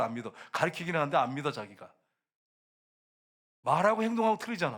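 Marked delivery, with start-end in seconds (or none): 3.6-4.8 loud, high-pitched preaching accusation; emphatic reproach. Real, not acted. Preacher calling out hypocrisy in church, loud and emphatic. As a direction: drive the charge home, voice raised. Preacher hammers point, then echoes it.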